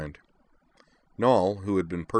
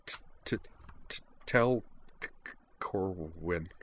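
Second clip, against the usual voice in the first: tearful stammer; 7 dB quieter than usual. A narrator's reading. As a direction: force each word through tears. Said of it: "Kate said".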